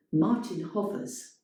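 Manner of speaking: ordinary delivery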